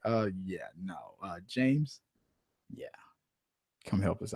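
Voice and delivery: sheepish sound crew quietly asking for help. Retold or imitated. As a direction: mumble the awkward request. Crew awkwardly asks James for help.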